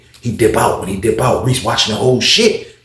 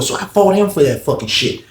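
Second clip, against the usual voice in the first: ordinary delivery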